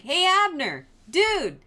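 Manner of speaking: high, loud call across the distance; shouting across. Retold or imitated. David shouting across the valley to Abner, loud call. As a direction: yell it from the far hilltop. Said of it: "close enough that he can shout".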